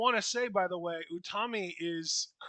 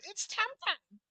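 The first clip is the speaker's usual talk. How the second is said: mock theme song; slower than usual. Imagined cutesy theme song.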